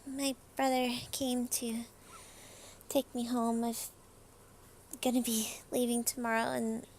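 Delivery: soft, slightly lifted character voice; lightly voiced Miki. Miki asks leave to say goodbye before going home, softly in character. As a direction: speak softly, quickening toward the goodbye.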